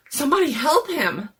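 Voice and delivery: high, loud, fast cry for help; panicked shout. Acted. Friend shouting for help as Jones collapses, panicked. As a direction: yell it as he convulses.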